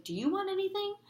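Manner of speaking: high, sweet shopkeeper voice; ice cream lady. Retold or imitated. Truck lady offering Harry a treat, high and sweet. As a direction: pipe up brightly like the truck lady.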